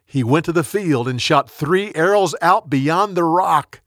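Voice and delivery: ordinary delivery, nothing marked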